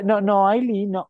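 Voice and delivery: high, cutting-off mock voice; mocking Mirka. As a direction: imitate her shutting Aileen down with a high no, no. (imitando a Mirka callando a Aileen con voz aguda)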